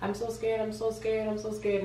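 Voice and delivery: anxious little chant, lightly acted; retold panic. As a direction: repeat it in a mild mock panic. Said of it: "I started hyperventilating. I started going crazy".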